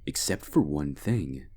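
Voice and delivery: ordinary delivery, nothing marked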